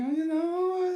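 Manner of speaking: very high, drawn-out musing; acted coy hedging. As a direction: slide way up as if mulling it over. Acting himself warming to the idea.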